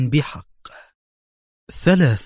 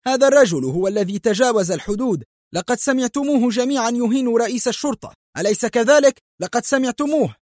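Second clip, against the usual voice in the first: much higher, fast appealing yells; rally everyone as witnesses; police chief appealing to the room, high indignant shouting; indignant outcry; 11 semitones higher, faster than usual. A narrator's reading. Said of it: "هتف جوزيه".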